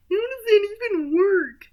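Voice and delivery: high, loud put-on voice; baffled sidekick. Sidekick questions the backhand gag, loudly baffled. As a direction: squawk it up high in disbelief.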